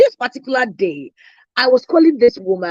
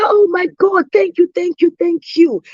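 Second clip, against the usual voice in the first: gushing thanks; 6 semitones higher than usual. Retold or imitated. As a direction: burst out with relief and gratitude. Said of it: "she was so thankful".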